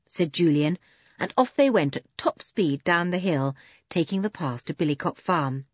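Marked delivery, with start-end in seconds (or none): none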